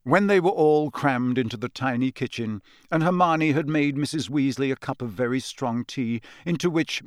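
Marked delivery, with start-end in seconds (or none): none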